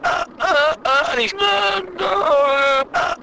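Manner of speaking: high strained gasping uhs; clutching-chest fake gasps, pitched high; mock soap heart attack. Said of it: "heart attacks they have on soap operas".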